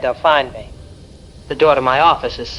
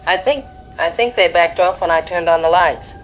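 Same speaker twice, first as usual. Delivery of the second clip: higher, tense, fearful voice; pitched up with fear, barely holding together; frightened; 6 semitones higher than usual. Acted. Trapped shop owner telling the radio host, frightened and higher. Trapped woman reporting creatures outside.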